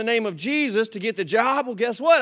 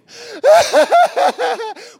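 high burst of laughter; 10 dB louder than usual. Real, not acted. Laughing at the lighters memory.

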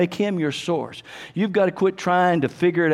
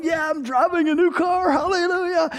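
higher, gleeful mock brag about the new car; retold joyful boast; 9 semitones higher than usual. Preacher recalls driving his new car, gleeful and higher. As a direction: crow it like a proud new car owner. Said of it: "and I'm going".

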